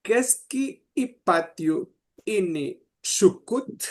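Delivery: Keski y patio ini shukut with hard K sounds at the start; Nahuizalco K sounds. Retold or imitated. Teacher imitating Nahuizalco speech, hard K. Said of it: "Pero ellas decían".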